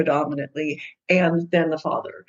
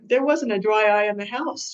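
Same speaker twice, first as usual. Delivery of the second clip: ordinary talk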